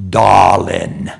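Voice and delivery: hammed-up husky diva impression; gravelly Tallulah voice. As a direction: drawl it like a grand old actress, rasping. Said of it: "she said in her gravelly voice".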